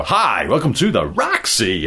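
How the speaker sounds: slow, overemphasised show welcome, pitch swooping; David K. Jensen. Retold or imitated. Co-host imitating TV presenter's greeting, overemphasised. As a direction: overdo the stress on Hi and Roxy. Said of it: "overemphasizing that a bit".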